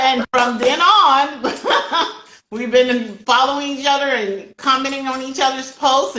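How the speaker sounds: happy laughing talk. Real, not acted. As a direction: giggle through the words. Laughing about meeting the guest.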